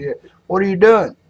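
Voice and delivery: high-pitched farmer calling out; mock confrontation, pitched well up; challenging farmer. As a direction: demand an explanation as the farmer. Farmer challenges lawyer in joke.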